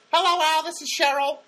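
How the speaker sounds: very high, loud caricature of an irritating woman; Cheryl's annoying voice. Retold or imitated. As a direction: mimic her in a piercingly high, grating voice. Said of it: "kind of an annoying voice".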